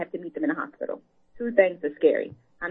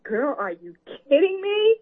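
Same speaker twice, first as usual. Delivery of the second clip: incredulous; 7 semitones higher, 5 dB louder than usual. Retold or imitated. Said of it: "I was like".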